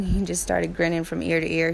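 usual speaking voice

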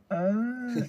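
high, drawn-out hesitant "uh"; reluctant wife imitation. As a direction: imitate a wife hedging before bad news. Retold wife reluctantly breaking bad news.

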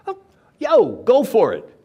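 loud, high, breezy encouragement; Jesus urging. Acted. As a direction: cheer him on, hammed-up. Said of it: "Jesus said".

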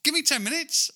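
high, loud eager answer; eager reply. Retold or imitated. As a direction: jump at the offer eagerly. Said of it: "And I'm like".

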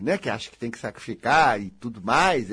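normal talking voice

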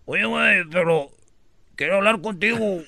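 muffled talk through a tied muzzle; mumbled ventriloquist words. Acted. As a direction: speak with the mouth held shut. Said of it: "era como ventríloco".

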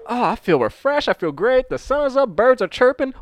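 high, dreamy morning cheer; blissful waking. Retold or imitated. Retold blissful waking before realizing lateness.

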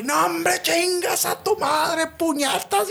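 high, slow, whiny gambler; the gambler outraged at the dealer, a high drawn-out whine; mock outraged gambler. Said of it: "el vato se caga".

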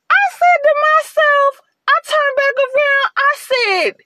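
worked up all over again; heated retelling. Retold or imitated. Telling how she snapped at the cashier, high and heated.

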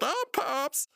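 very high cartoon voice; Pops. Meeting a worker's brother, high mock introduction. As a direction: introduce yourself in a silly high voice. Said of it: "And Pop's like".

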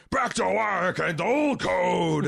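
loud, slow, gravel-laden hero voice; mock the actor's gravelly growl, pushed up and drawn out; Dennis Quaid. Host mocking actor slipping out of British vowels, gravelly shout. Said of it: "two dump trucks worth of gravel into his voice".

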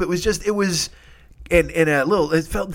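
ordinary talk, nothing marked

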